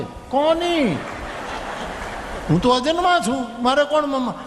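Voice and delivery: high, startled question as Lord Shiva; astonished Shiva. Acted. Shiva answers his attendant. (નવાઈ પામેલા શિવજી)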